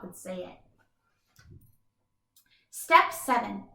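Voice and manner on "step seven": plain reading voice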